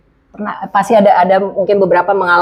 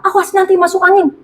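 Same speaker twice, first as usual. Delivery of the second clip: worried parent; 8 semitones higher than usual. Retold or imitated. Parent warning child.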